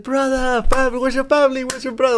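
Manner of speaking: very high, gushing buddy voice; overexcited guy greeting his pal, way up high; eager friendly opponent. Retold or imitated. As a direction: squeal out the greeting like an overjoyed friend.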